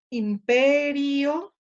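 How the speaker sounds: "imperio" slow, high and loud on a long PE; stressed syllable drawn out. Shown as a demonstration. Teacher tries the marked word, "imperio" with drawn-out stressed PE. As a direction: stretch and lift the PE in "imperio". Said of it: "acentuándola, y entonces leo".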